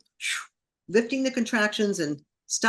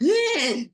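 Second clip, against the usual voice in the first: high imitated gasping squeal; sudden animalistic gasp, demonstrated; boar-like screech; 11 semitones higher, 4 dB louder than usual. Shown as a demonstration. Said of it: "same exact boar-like sound".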